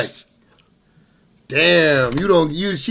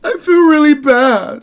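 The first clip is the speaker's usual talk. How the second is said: high, slow, mock-sorry voice, louder; mocking imitation; 11 semitones higher, 5 dB louder, slower than usual. Streamer mocking the crying woman's apology, slow high mock. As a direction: parrot her apology in a whiny mock tone.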